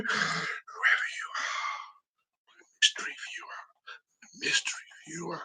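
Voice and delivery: very soft, slow stage whisper; mysterious whisper. Pretend. Host greeting an unknown viewer, mysterious whisper. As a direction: whisper it like a secret.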